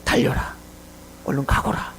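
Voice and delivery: a broken, breathless whisper; barely able to get the words out for tears; weeping, whispered farewell; tearful whisper. Acted. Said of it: "그리고 막 눈물을 흘려요".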